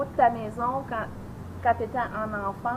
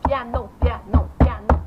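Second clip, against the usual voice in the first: piano slow and louder; stress on piano; 10 dB louder than usual. Pretend. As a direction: hammer each piano. Coaching him loudly.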